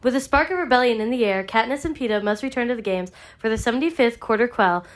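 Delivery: ordinary talk, nothing marked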